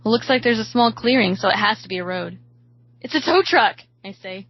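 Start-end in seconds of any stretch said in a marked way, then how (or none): 3.0-3.8 raised, higher excited exclamation. A narrator's reading. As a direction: announce a hopeful sighting, pitched up. Spots rescue, both become excited.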